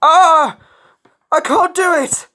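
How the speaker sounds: high, loud wail of failing confidence; despairing high-pitched yelp; panicked cry. A narrator's reading. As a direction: cry out as your nerve fails. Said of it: "he could feel his confidence fading".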